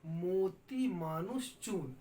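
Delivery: slow, drawn-out couplet recitation; measured teacher's verse reading. Acted. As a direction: recite the doha slowly, stretching each word.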